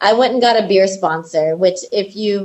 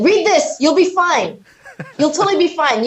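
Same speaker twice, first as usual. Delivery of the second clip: breezy reassurance; 7 semitones higher than usual. Retold or imitated. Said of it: "And he said".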